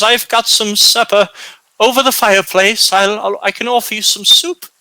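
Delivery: put-on high, eager old-woman voice; hospitable old woman. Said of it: "and she says".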